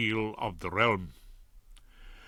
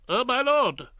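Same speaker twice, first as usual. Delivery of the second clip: flustered Ballard; 11 semitones higher, 4 dB louder than usual. Acted.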